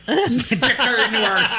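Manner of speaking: slow, high laughing mimic of the accent; laughing copy of a Chicago accent; imitating Chicago accent. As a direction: echo it laughing in a mock Chicago voice. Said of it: "Got this great Chicago accent".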